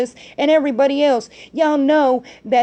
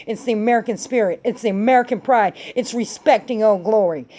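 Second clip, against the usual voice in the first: normal talking voice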